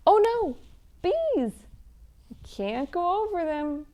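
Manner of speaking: bouncy, sing-song picture book chant; lively read-aloud for children. Acted. Reading an Easter egg hunt story to children, sing-song. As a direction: read it like a game for little ones.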